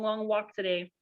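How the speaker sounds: ordinary delivery, nothing marked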